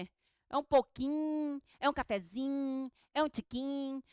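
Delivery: ordinary talk, nothing marked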